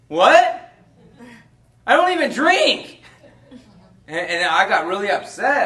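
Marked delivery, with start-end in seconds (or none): 0.1-3.0 high, loud outraged "What?"; a stunned man hearing a false charge, shouting loud and high; shocked disbelief. Retold or imitated. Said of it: "I was like".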